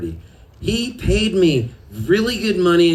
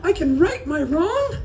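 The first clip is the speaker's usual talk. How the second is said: excited disbelief; 12 semitones higher than usual. Retold or imitated. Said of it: "I go".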